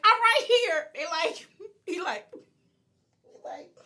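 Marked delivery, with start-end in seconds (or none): 0.1-0.9 loud, high call from inside. Retold or imitated. Going to the door as police ask for her, calling out. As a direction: announce yourself loudly to the door.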